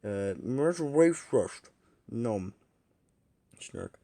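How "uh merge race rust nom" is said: gobbling and mumbling through bacon; eating noisily. Acted. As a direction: talk while wolfing down food. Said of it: "don't make sounds like that".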